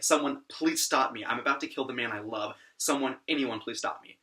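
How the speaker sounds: ordinary talk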